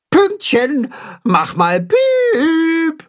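high, drawn-out coaxing call. A narrator's reading. Timme coaxes hidden Pünktchen, high and slow. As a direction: sing it out slowly and high to lure someone out.

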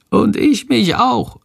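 usual speaking voice

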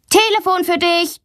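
high, sing-out announcement; calling out. A narrator's reading. Brother wakes sister for a phone call, calling out.

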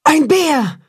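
high-pitched alarmed shout; frightened cry. A narrator's reading. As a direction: call out in sudden fright. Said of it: "ruft die Wolfsmutter erschrocken".

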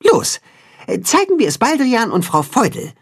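rally the friends onward; directing friends. A narrator's reading. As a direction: Timme directing the animals. Cat-chicken directs.

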